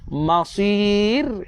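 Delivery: "Masir" with heavy s, light final r; thick to thin. Shown as a demonstration. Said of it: "pertukaran tebal kepada nipis".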